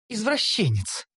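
disgusted elf. A narrator's reading. Elf scorning hero's leering, disgusted. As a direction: toss the word with disgust. Elf calls him pervert.

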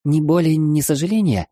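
put-on high, surprised young girl. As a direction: gasp it out in girlish surprise. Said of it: "удивилась она".

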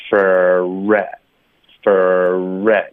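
very slow "Fur re", held r pushing into "re"; lip-rounding drill. Shown as a demonstration. Teacher showing lip movement, slow stretched "Fur re". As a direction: stretch "Fur re" through puckered lips. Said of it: "大家注意这个嘴唇的动作".

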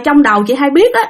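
ordinary delivery

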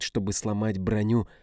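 ordinary delivery

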